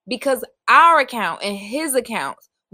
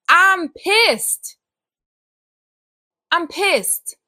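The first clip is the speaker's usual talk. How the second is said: angry outburst; 7 semitones higher, 4 dB louder, much slower than usual. Real, not acted. Venting about her father's suicide.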